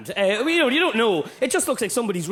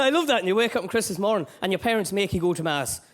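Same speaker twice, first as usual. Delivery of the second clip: ordinary talk, nothing marked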